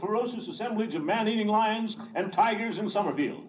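high booming ringmaster announcing; ringmaster announcement. Acted. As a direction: announce it grandly to the big top. Ringmaster presents the final act.

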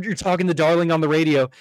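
ordinary talk

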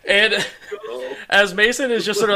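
usual speaking voice

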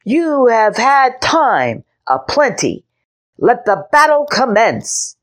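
put-on a six-year-old knight declaring battle; boyish knight's proclamation. A narrator's reading. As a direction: proclaim like a little boy playing knight. Henry continues.

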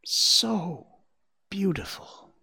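high voice fading into breath; hushed, high wonder of a young fawn; awed thought. A narrator's reading. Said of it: "and then again".

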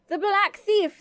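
high, forceful storybook roar; roaring giant. A narrator's reading. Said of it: "the giant roared".

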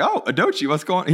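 high, light mock greeting of dad; imitating dad greeting. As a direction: play dad's cheerful surprise in a high voice. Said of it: "he's like".

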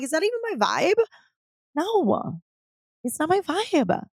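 high, drawn-out comic realization; mock self-questioning. Asking herself about the Valentinos, exaggerated mock verdict. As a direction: ask it high, then answer slowly and emphatically.

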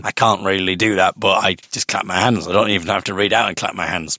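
ordinary delivery, nothing marked

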